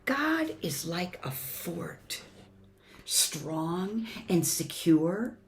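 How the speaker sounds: storybook reading with swelling and hushed lines; gentle expressive story voice for the kids; reading to children. Acted. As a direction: read it like a bedtime picture book. Reads picture book to congregation.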